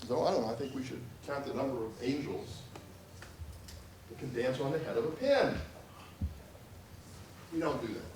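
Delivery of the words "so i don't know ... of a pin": soft, musing mock-academic voice; hushed parody of a professor; caricatured professor. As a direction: ponder quietly like an ivory-tower professor. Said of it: "I'm obviously making a caricature".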